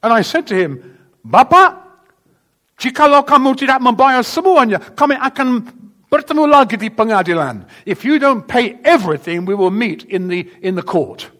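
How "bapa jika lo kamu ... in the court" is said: loud, high furious warning; angry threat. Retold or imitated. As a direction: threaten him with court, shouting. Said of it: "I was really angry".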